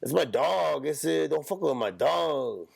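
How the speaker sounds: put-on high, drawn-out Mexican accent; exaggerated Mexican-accented warning. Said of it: "They always sound".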